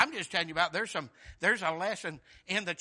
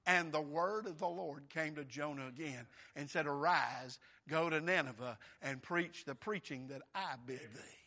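low, softer, measured Bible reading; scripture recitation; 6 semitones lower, 4 dB quieter than usual. Real, not acted. Preacher reading God's second call to Jonah, low solemn recitation. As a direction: read God's command gravely.

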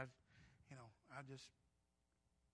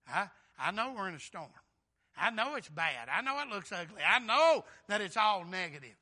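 louder with each "I know"; rising preaching build; 4 semitones higher, 14 dB louder than usual. Acted. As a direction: stack each line louder, like a preacher climbing.

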